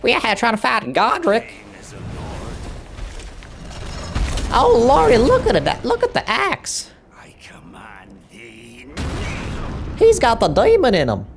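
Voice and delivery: high, hammed-up American Southern holler; American Southern accent. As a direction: play an excitable backwoods American Southerner. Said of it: "Speak in an American Southern accent".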